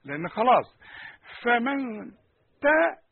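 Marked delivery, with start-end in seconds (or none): 1.4-3.0 "فمن تا" with a high, thin, light تا; exaggerated light ta. Shown as a demonstration. Teacher showing the light ta sound, higher and louder. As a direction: snap out a light تا after "فمن". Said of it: "اوعى تقول لي".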